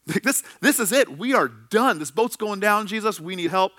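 frantic disciples shouting over the storm; panicked disciples. Retold or imitated. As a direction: cry out to a sleeping man as the boat sinks. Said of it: "in a panic, are asking him".

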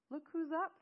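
bright, loud happy call; excited exclaim. A narrator's reading. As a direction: call it out delighted. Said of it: "Fairchild exclaimed".

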